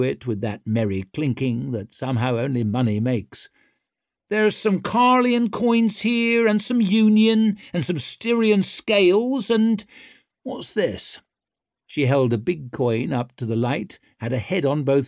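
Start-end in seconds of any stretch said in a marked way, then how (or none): 4.3-11.2 high, cocky and loud; swaggering rogue taunting; brash young woman. A narrator's reading. Ricker voice.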